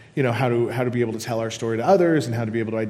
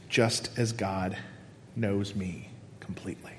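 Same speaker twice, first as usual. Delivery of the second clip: slow, softer and lower into a whisper; fading whisper; 4 semitones lower, 8 dB quieter than usual. Acted. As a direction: let the verse die into a whisper. Verse ending fades to whisper.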